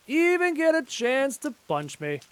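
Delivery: high, drawn-out playful echo; mock repeat. Echoing his own boast mid-fight, high mock repeat.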